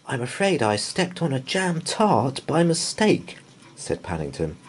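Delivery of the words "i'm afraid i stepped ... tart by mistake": lightly higher, sheepish confession; apologetic bear. A narrator's reading. Paddington explaining the jam to Mrs Bird, apologetic. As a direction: admit it meekly.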